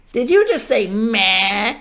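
high bleating goat's question; inquiring goat. A narrator's reading. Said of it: "inquired the goat".

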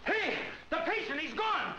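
high urgent alarm; alarmed call. Acted. Surgeon calling staff after escaped patient. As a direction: raise the alarm fast.

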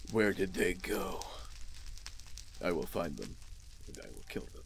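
low, very hushed vow of revenge; vengeful son. Acted. Son asks father where the attackers went, low menacing hush. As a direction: drop deep and quiet, fading almost to a whisper.

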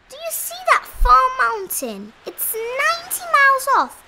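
high-pitched showing-off rabbit; boastful rabbit. A narrator's reading. Rabbit telling the bear what he heard, high and boastful. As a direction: tell the tall tale proudly, pitched high.

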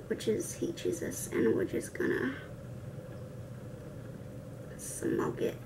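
hushed whispered aside; whispering. Real, not acted. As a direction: whisper it under your breath.

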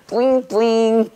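high, drawn-out cutesy squeal; cutesy kid act. Acted. Teacher imitating adorable kids for the class, cutesy. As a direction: play up the cuteness in a high voice.

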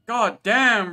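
sudden high startled outburst; surprised exclamation. Real, not acted. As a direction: blurt it out in shock. Startled by friend's surprise appearance.

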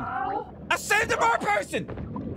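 gleeful high brag. Real, not acted. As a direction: stretch it out, delighted. Proud cry after saving.

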